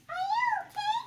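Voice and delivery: high worried piglet voice; Piggie voice. Acted. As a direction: ask like a little pig, concerned. Piggie asks Gerald.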